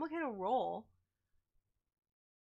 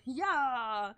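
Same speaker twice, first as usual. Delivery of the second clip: loud, raised mock battle cry; 4 semitones higher, 7 dB louder than usual. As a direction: belt out the yell. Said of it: "the very loud".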